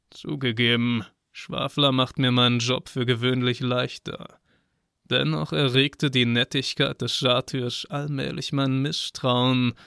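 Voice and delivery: ordinary delivery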